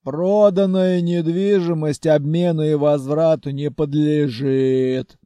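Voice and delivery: bass voice. A narrator's reading. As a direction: declare it in a heavy bass. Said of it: "также басом заявила Халя".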